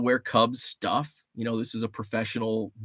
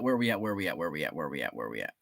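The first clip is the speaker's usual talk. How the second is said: each repeat softer and lower, dying out; fading away; 9 semitones lower, 9 dB quieter, much faster than usual. Pretend. Scrolling back through chat, voice fading lower and softer. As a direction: let it trail off into nothing.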